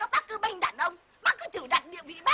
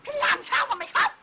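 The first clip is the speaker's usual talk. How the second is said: sharp, higher-pitched husband's demand; bullying husband; 6 semitones higher, 4 dB louder than usual. Acted. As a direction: play the husband demanding an explanation. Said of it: "Em làm chồng em".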